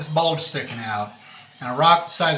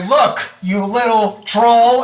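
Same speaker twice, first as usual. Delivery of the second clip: loud, slow, higher-pitched warning; acted threat; 8 semitones higher, 9 dB louder, slower than usual. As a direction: square up to the troll, stretching the words. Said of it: "right at him like this and say".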